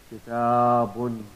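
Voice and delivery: kitabun drawn out slowly, each syllable clear; slow demo word. Shown as a demonstration. Teacher modelling kitabun slowly for students. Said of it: "We write it like this".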